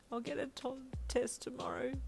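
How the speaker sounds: high-pitched weepy wail; understated high wailing. A narrator's reading. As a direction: wail it weakly through tears. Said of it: "you wailed".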